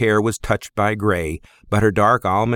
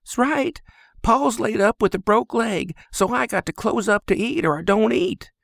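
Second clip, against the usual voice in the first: put-on much higher young woman's voice; Lizzie, girl's voice; 13 semitones higher than usual. Stable girl asks the stranger, much higher young woman's voice. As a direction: ask it straight, a bit wary.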